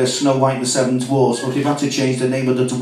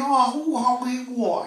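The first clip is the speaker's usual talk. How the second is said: mock Chinese accent; 11 semitones higher, 5 dB quieter, slower than usual. Chinese chip shop owner voiced.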